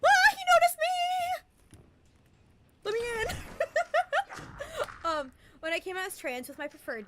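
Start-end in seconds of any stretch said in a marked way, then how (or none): 0.0-3.4 very high, loud, slow drawn-out shriek; real excited squeal. Real, not acted. Streamer reacts to being noticed. 3.4-5.0 shrill giggle after the shriek; high squealing laugh. Real, not acted.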